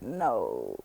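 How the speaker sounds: low, ashamed, barely there. Retold or imitated. Answering the guru in shame, a low ashamed no. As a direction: drop it with your head down. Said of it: "I hung my head and I uttered".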